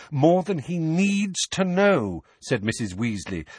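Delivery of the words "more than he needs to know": high, insistent emphasis; firm, raised motherly voice; stern rebuke. A narrator's reading. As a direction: press the point on the last words.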